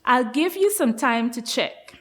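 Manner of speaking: ordinary talk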